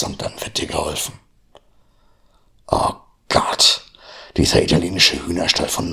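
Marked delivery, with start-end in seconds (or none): none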